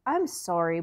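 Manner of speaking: ordinary delivery, nothing marked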